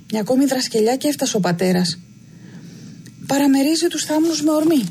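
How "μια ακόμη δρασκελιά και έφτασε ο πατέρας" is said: ordinary delivery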